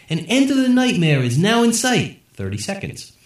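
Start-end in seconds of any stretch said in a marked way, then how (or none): 0.1-2.2 presidential address. A narrator's reading. President speech on TV.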